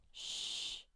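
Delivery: soft unvoiced shh for a silent letter; soft shh showing a silent letter; shushing sound. Shown as a demonstration. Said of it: "not even there".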